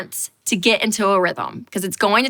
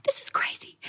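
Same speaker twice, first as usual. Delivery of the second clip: hushed whisper to herself; whispered aside; 11 dB quieter than usual. Retold or imitated. Muttering to herself over the menu, whispered. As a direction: breathe it so nobody hears.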